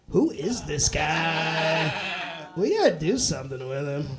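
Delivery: lightly voiced low, impressed executive; Netflix executive. Voicing Netflix execs noticing him.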